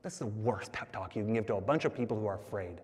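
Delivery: low, soft and quick; muttered inner thought. Acted. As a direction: mutter it low as a private aside. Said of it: "you're thinking to yourself".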